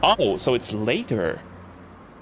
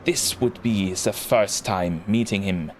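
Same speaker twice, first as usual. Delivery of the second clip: put-on German accent. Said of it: "Like, really lean into it".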